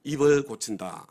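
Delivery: loud, slow call for the congregation to repeat; preacher's loud, deliberate repeat-after-me line. Shown as a demonstration. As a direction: proclaim it slowly so they echo you. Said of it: "따라서 하겠습니다".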